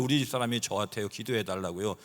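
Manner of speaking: usual speaking voice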